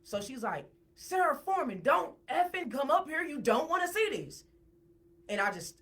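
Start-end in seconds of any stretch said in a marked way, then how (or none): 0.9-4.4 loud, high-pitched retold order; urgent warning. Woman stops friend coming up the steps, urgent imitated shout.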